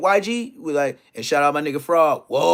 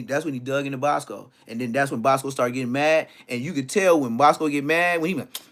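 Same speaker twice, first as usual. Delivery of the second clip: usual speaking voice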